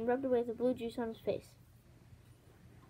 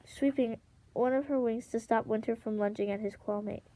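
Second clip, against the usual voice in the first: plain reading voice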